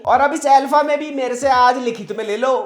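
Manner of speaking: normal talking voice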